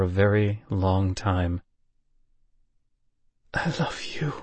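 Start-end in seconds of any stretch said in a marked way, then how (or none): 3.5-4.3 soft breathy whisper. A narrator's reading. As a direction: breathe it barely audible. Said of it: "whispered something".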